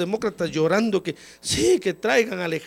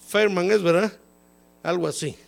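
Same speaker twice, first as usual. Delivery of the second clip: normal talking voice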